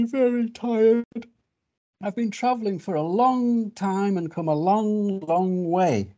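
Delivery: high, drawn-out weary plea; exhausted strange animal. Acted. Strange animal apologising, needing a rest after long travel, exhausted. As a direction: stretch the words out high, worn out from travelling.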